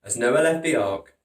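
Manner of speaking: plain reading voice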